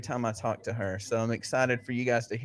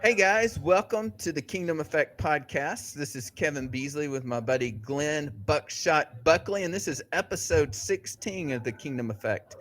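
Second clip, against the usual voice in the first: bright, higher host welcome; podcast intro; 4 semitones higher than usual. Acted. Host on take three of the intro, cheerful announcer voice. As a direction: start the show fresh, upbeat.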